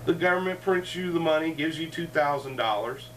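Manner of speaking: usual speaking voice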